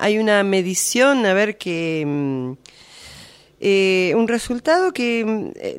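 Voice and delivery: ordinary delivery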